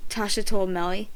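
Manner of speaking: plain reading voice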